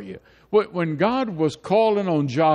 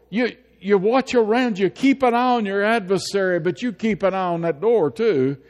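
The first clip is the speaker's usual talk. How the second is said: rally the church to courage; preacher urging the flock on; fiery exhortation; 5 semitones higher than usual. Acted.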